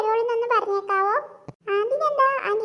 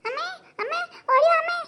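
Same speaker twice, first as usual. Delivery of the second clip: calling mother; 6 semitones higher than usual. Acted. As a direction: shout for mum to come running. Child calls mother from outside.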